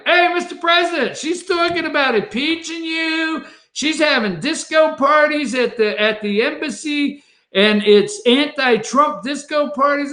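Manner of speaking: hamming up a donor calling out; mock donor tattling. Donor yelling at Trump.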